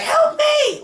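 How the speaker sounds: very high-pitched retold cry for help; mock desperate plea. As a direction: imitate a frantic yelp for help. Voicing Logan's desperate plea to Laura.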